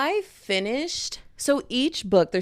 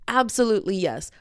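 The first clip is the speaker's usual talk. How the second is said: usual speaking voice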